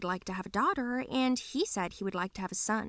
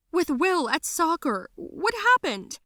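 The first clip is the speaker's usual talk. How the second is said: high, louder alarmed reply; freaked-out mother; 8 semitones higher, 5 dB louder than usual. A narrator's reading. Mother confronted with the papers, freaked out. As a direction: voice a startled, anxious mom. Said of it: "who freaked out".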